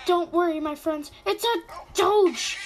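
high, loud excited cry; excited squeal. Real, not acted. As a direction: gush over the pet. Excited at finding a pet.

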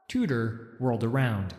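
plain reading voice

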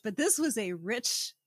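normal talking voice

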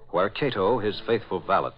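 normal talking voice

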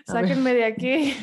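mock despair through laughter; laughing plea. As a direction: plead jokingly while laughing. Joking despair about the topic. (súplica entre risas)

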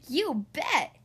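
put-on loud, punchy child's cheer; eager yell. A narrator's reading. Lester volunteers to help Dad, yelling. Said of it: "yelled Lester".

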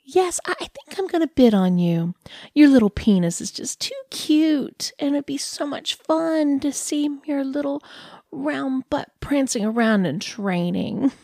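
a lightly voiced cooing dog owner; condescending dog lady. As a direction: talk down to him like a pet, all sweetness.

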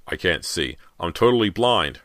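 father realizing he is blind, horrified; choked with horror. Acted. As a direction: voice choking on the horror. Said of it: "in a voice choked with horror".

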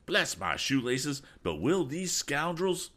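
lightly voiced outrage; a hint of an indignant elder; indignant old gent. A narrator's reading. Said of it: "asked Mister Damon".

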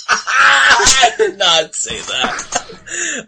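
slow, laughing through the words; laughing denial. Real, not acted. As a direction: deny it while cracking up. Laughing denial of misheard word.

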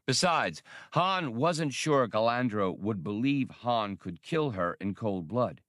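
ordinary delivery

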